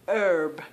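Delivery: herb said as "erb" with a hard R and no H; American herb. Shown as a demonstration. Showing the British viewers how Americans say herb, "urb". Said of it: "I'm gonna say it like I hear it".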